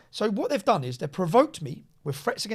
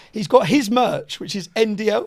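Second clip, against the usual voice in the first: loud, drawn-out words breaking into laughter; laughing; 3 semitones higher, 7 dB louder, slower than usual. Real, not acted. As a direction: struggle to tell it through real laughter. Laughing over a retold prank.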